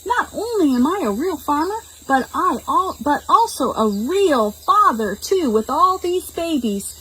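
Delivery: high delighted voice; thrilled new father; excited farmer. Acted. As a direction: brag high and happy. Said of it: "he shouted".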